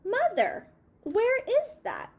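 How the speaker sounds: high, eager little mouse voice. A narrator's reading. Little mouse asks his mother.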